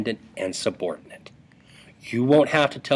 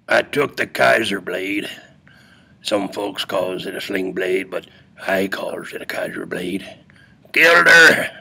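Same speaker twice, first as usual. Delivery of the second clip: Carl impersonation; 3 semitones lower than usual. Retold or imitated. Teacher quoting Carl's Kaiser blade line, slow drawl. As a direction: murmur it slowly like Carl, then bark the end. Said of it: "I do a pretty spot-on Carl impersonation".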